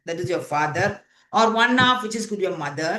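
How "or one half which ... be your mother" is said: usual speaking voice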